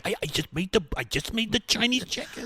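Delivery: stammering, high comedian impression; Woody Allen. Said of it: "Oh, you think of Woody Allen?".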